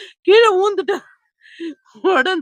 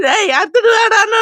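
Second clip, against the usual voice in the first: robber's order; 6 semitones higher, 5 dB louder than usual. Retold or imitated. Robber voiced in retelling.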